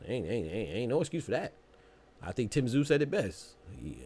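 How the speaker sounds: normal talking voice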